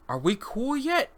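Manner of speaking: slow, high, drawn-out question; eerie last question. Acted. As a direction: ask it slowly in an otherworldly high voice. Catatonic subject's eerie last question.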